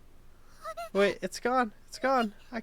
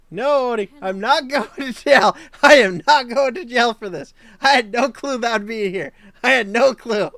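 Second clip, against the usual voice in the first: loud, laughing denial to the chat; loud laughing protest; 3 semitones higher, 12 dB louder than usual. Real, not acted. Insisting loudly to chat she won't go to jail. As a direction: protest loudly through laughter, no way it's my fault. Streamer protests, bird hits window.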